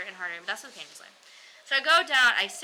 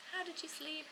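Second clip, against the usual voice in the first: soft, higher, caring nurse; gentle nurse voice; 8 semitones higher, 11 dB quieter than usual. Retold or imitated. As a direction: imitate a soft-spoken nurse asking kindly. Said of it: "just very soft and like women".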